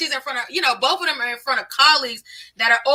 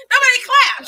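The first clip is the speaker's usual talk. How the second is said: much higher, loud amused yell; laughing shout; 11 semitones higher, 4 dB louder than usual. Real, not acted. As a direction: cut in laughing, insisting. Laughing correction of the story.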